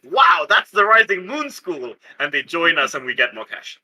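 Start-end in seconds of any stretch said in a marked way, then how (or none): 0.1-1.9 impressed crowd. Retold or imitated. Townsfolk marveling at the crew's daring heist, awed shout. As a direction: cry out like dazzled townsfolk. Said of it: "people are like".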